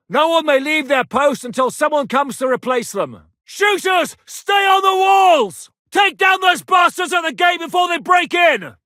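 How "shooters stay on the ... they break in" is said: shouted orders. Acted. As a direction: command the archers over the din. Ordering archers on the walls.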